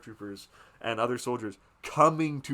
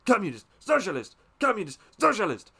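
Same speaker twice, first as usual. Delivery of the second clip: each "Communist, socialist" louder and higher; mocking yell, building; 6 semitones higher, 7 dB louder than usual. The father shouts down his son, rising to a yell. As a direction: shout it down, escalating. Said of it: "just yelling at each other".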